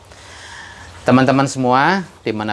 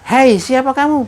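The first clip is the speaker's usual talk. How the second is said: high, loud call out; much higher shout to a stranger; 12 semitones higher, 4 dB louder than usual. Retold or imitated. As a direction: yell across the mountains. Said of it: "Dia coba lagi berteriak".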